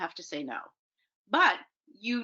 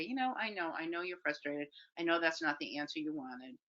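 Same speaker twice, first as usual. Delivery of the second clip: soft, understanding voice, much quieter; soothing parent; 3 semitones lower, 9 dB quieter than usual. Acted. Parent acknowledging a frustrated kid's disappointment, softly soothing. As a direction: say it gently, letting the volume drop right down.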